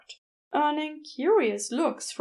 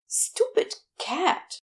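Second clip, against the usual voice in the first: slow, higher, exasperated; annoyed outburst; 7 semitones higher, slower than usual. Acted. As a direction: spit it out as a fed-up shopkeeper. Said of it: "Gabriel said, pacing".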